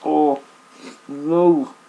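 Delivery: loud pained groan of disgust; disgusted cry. Real, not acted. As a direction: wail in real disgust. Reacting to eating raw leek.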